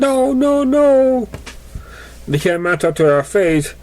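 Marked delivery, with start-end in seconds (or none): 0.0-1.3 desperate. Acted. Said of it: "She uttered over and over".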